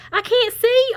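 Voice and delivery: high loud panicked voice; high distressed turkey. Acted. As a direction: squeal that he can't see. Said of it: "Ollie said".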